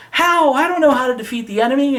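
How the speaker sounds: high, fast, whiny mock panic; hammed-up helpless girl. Sailor Moon panicking at the enemy, in a high, fast mock whine. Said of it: "she's like".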